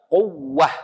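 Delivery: quwwah with a lifted, pressed ww; nabr stress. Shown as a demonstration. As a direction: push up on the ww in quwwah.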